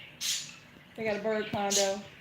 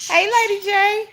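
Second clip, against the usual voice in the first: much higher, louder hello; sing-song greeting; 11 semitones higher, 7 dB louder than usual. Real, not acted. Welcoming Lady J to the live, high loud sing-song hello. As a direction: call her in up high.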